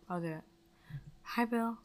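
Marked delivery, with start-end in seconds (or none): 1.2-1.8 playing Rachel. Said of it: "You be Rachel".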